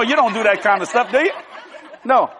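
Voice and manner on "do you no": ordinary talk, nothing marked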